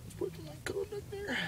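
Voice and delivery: high, soft call in an official's voice; a hint of an official ruling; referee call. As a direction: announce the penalty like a referee.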